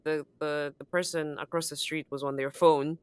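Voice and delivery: ordinary delivery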